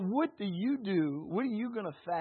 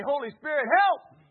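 high, loud call to the Holy Spirit; crying out for help; 7 semitones higher, 10 dB louder than usual. Acted. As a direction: lead the crowd in a shouted plea. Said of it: "cry out for help".

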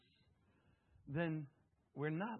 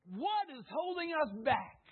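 emphatic preaching; 6 semitones higher, 3 dB louder than usual. Acted. Preacher repeats the question twice.

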